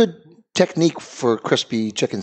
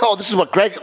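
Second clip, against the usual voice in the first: coughing shout; 9 semitones higher than usual. Real, not acted. Coughing shout mid-sentence.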